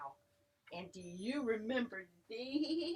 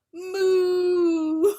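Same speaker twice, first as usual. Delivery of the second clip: loud, higher mooing cow; 7 semitones higher, 14 dB louder than usual. Retold or imitated. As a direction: belt out a cow's moo. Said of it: "a cow says".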